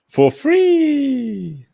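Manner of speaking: high, loud, drawn-out squeal on free; gleeful squeal. Pretend. Streamer showing Robert a free find, gleeful high squeal. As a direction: crow it way up high, stretching the last word.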